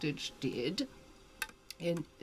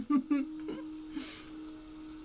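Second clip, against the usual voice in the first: light high giggle; high soft laugh; 9 semitones higher, 4 dB quieter than usual. Real, not acted. Chuckling after ghostly reading.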